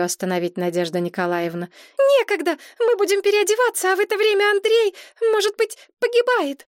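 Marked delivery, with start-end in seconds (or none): 2.2-6.7 high, fast worried voice; anxious hurry. A narrator's reading. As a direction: rush it, high with alarm. Maria rushing anxiously.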